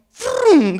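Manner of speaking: high, sudden "frum" zoom; vocal swoosh of a rushing child; whoosh noise. Retold or imitated. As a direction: imitate something small whizzing up. Imitates child rushing at him.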